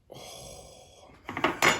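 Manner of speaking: ordinary talk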